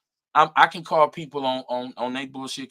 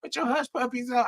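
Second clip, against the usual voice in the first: mock-condescending, high-pitched; 12 semitones higher, 4 dB quieter than usual. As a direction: talk down to him, teasing. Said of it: "she said".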